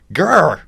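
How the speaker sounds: short growl, teeth bared; growl of frustration. Real, not acted. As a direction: grr at the end of a rant. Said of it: "micro rant".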